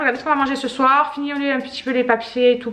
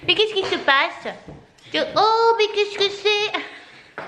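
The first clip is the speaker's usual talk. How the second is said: put-on much higher, slow, drawn-out baby talk; high pet-talk voice; 11 semitones higher, much slower than usual. Mother cooing at her jumpy dog in slow high baby talk.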